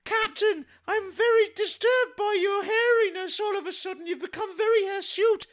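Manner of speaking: woman complaining to the hairy captain in a high falsetto; lady voice. Pretend. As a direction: play a flustered lady in falsetto. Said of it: "here comes a lady".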